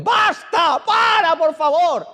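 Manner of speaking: very high, desperate drawn-out cries; acted-out prophet's anguished yelling; shouting at God. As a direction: scream to heaven, begging to die. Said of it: "empieza a gritarle a Dios".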